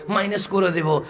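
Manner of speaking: ordinary talk, nothing marked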